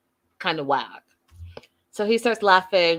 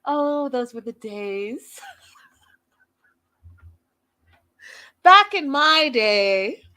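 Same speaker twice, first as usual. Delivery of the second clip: nostalgic sigh, lightly playing an old lady; wistful mock old-timer looking back; mock old-timer reminiscing; 6 semitones higher, 5 dB louder, much slower than usual. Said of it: "reminiscing old-timer mock".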